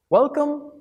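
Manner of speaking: gentle welcome. Shown as a demonstration. Coach shows the right opening at a pitch, gentle. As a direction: offer the word kindly.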